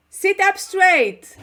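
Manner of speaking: slow, high, loud command; strict mother. Shown as a demonstration. Teacher barking a posture order at students, slow and loud. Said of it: "you sounds like my mother".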